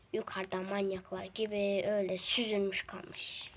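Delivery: soft whisper to keep quiet. Real, not acted. Boy whispering to viewers while mom sleeps, whisper. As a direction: whisper so mom stays asleep. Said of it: "Sessiz olmalıyım".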